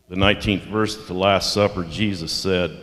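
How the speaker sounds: ordinary talk, nothing marked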